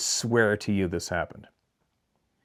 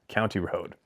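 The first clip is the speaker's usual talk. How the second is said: plain reading voice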